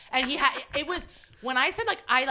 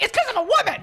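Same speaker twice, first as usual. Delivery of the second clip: higher and louder, fed up; indignant outburst; 11 semitones higher, 7 dB louder than usual. Retold or imitated. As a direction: blurt it out in mock outrage. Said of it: "I'm like".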